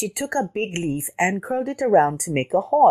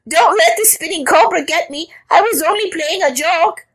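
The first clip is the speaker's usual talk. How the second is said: high-pitched hammed-up terrified squeal; panicked pleading; 11 semitones higher, 4 dB louder than usual. A narrator's reading. Scared caterpillar begs for protection, panicked cry. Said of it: "he cried".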